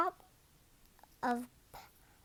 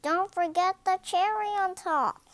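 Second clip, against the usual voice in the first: calling out; 8 semitones higher, 8 dB louder than usual. A narrator's reading. Crowd child calls out.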